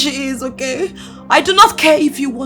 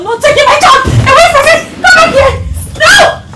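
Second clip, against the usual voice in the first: high, loud frantic refusal; loud, high-pitched protest; desperate shouting; 11 semitones higher, 7 dB louder than usual. Acted. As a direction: shout after someone taking your child. Mother fights to keep child.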